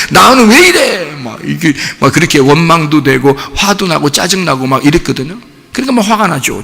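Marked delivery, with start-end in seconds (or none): 0.1-1.1 high, drawn-out mock lament; acted self-pitying complaint. Preacher mimicking his old "why me" resentment, high lament. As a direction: stretch it into an aggrieved wail.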